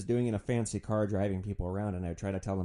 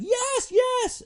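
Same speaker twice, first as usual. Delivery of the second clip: very high frantic squeal; panicked yes; 6 dB louder than usual. Retold or imitated. Frozen kids squealing agreement to the driver, frantic. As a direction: yelp it out in desperate agreement.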